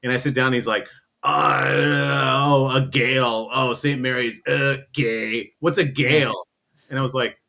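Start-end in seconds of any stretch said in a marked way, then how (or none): 1.5-6.5 imitated drunk, louder drawn-out "Oh"; drunk guy. Drunk fan greets a rival in Vegas, mock drunken drawl. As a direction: act a heckling drunk at the table. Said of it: "he's drunk as fuck".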